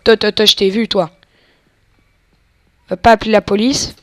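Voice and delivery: loud threatening orders; barking at hostages. Pretend. Gamer yelling at the hostages in a loud threat. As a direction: shout them down, loud and firm.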